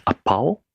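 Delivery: apao as mis-stressed apple, a-POW; apple said wrongly as apao, end stressed; stress on second syllable. Shown as a demonstration. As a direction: put the weight on pao in apao. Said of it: "placed stress on the second syllable".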